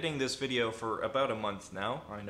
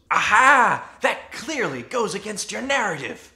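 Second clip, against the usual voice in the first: high, loud mock "gotcha" voice; triumphant objector; 9 semitones higher, 11 dB louder than usual. Imagined critic pouncing on a flaw in the argument, hammed-up triumph. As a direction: burst in crowing, hammed-up.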